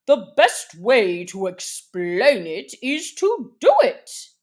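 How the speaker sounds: a hint of a pompous dodo; lightly voiced dodo explaining. A narrator's reading.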